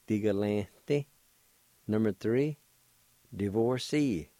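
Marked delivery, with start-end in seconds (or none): 0.0-1.1 "Di, ga, lan, di" run together as one word; connected take of "Di, ga, lan, di"; joined-up pronunciation. Shown as a demonstration. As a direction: blend "Di, ga, lan, di" smoothly. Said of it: "like this".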